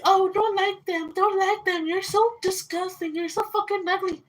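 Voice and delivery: high, sneering imitation of the friend; mocking quote of friend. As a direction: mimic her nasty put-down in a high voice. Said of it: "she's like".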